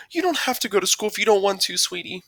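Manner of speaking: high-pitched mom voice, concerned; reassuring mom. A narrator's reading. Mom tells son school is optional, reassuring. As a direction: play a mom offering her child a way out.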